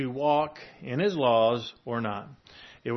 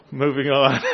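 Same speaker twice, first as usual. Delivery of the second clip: louder, pushing on through a laugh; 7 dB louder than usual. Real, not acted. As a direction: laugh it off and move along. Laughs at own failed cleverness.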